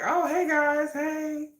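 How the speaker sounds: high, small, soft hello; shy greeting. Acted. Introvert on a group video call, high soft shy greeting. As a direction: greet timidly, acting out camera shyness. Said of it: "I would just sit there and be like".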